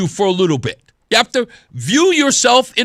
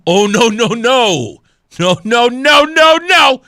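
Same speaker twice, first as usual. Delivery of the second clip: mock-outraged refusal; 4 dB louder, slower than usual. Host mock-defending the older congresswoman, emphatically scandalized. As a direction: protest theatrically, building with each no.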